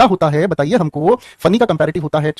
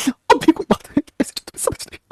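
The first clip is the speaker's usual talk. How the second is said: sudden squeaky high voice, fading to a whisper; 9 semitones higher, 3 dB quieter, slower than usual. Pretend. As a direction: pipe it up high, then drop to a whisper.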